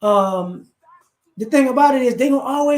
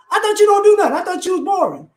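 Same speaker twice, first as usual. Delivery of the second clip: 1 shocked disbelief; 9 semitones higher, faster than usual. Acted. Friend shocked at boring pal.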